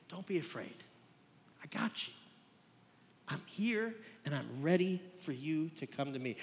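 soft, hushed comfort, nearly whispered; tender reassurance. Acted. Preacher voicing God to doubting listeners, soft and tender. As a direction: speak it gently, as God to a frightened child.

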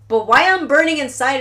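ordinary delivery

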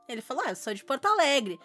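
usual speaking voice

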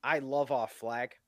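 slow, put-on American dude; mock American accent, dropping low. Shown as a demonstration. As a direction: play a dim American, drawn out. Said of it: "supposed to be an American accent".

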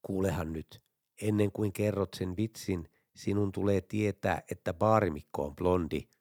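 low, hushed voice from alongside; mock whisper. A narrator's reading. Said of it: "Vierestä kuuluu kuiskaus". (matala, hiljennetty kuiskausta matkiva ääni)

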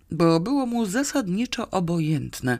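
ordinary delivery

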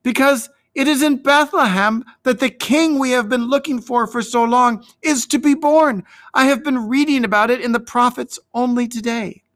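put-on high, eager boy's voice; excited boy. A narrator's reading. Young David tells shepherds of the coming king, high and excited. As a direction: pour it out with youthful wonder.

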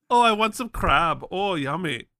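put-on joking German-accented Baltimore local; comic German accent, relishing the food; hammed-up bad German accent; mock German accent. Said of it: "where everyone talks like this".

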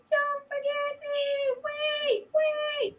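very high mock cry, held back in volume; pleading call. Missy running towards the leaving boat, high pleading call.

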